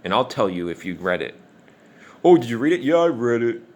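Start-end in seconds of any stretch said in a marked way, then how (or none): 2.2-3.6 put-on higher voice, louder; mock a glib question and answer; mocking acted exchange. Asked whether he read the essays, mock glib claim.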